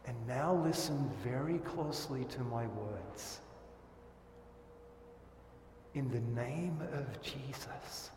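hushed pastoral pronouncement; solemn absolution. Acted. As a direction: speak forgiveness gently to each listener.

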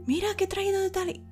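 high eager offer; offering host. Retold or imitated. Anfitrión ofrece, voz aguda. (anfitrión ofreciendo comida, agudo)

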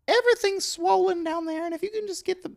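high-pitched awkward store guy; squeaky oddball coworker voice; high character voice. Acted. Clint character continues.

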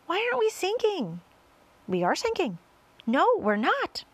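high-pitched bickering back and forth; anxious squabble. A narrator's reading. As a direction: argue in rising alarm. Characters argue over whether they sink.

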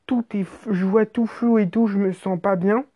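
usual speaking voice

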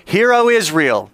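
high, loud call for an echo; leading a response. Shown as a demonstration. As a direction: call it out for the room. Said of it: "leads the English response".

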